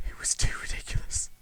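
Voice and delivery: faint breathless whisper; dying whisper. Acted. Dying man whispers his verdict, faint. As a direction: whisper like your last breath.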